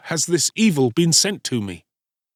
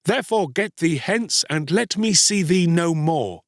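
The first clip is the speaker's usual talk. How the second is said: bitter, higher-pitched reproach; hard and bitter; 7 semitones higher than usual. A narrator's reading. Ashamed boy rejecting his mother, hard and bitter. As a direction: shame her and send her off. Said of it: "his voice was hard and bitter".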